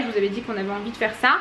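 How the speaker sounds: ordinary talk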